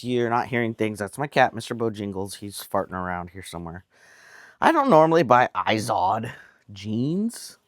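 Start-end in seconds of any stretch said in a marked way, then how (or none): 4.6-6.3 "Izod" said with a quirky rising lilt; odd pronunciation. Real, not acted. Telling viewers about the jeans, saying "Izod" with a quirky rise. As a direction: stretch "Izod" oddly, as a whim. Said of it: "I don't know why I said it like that".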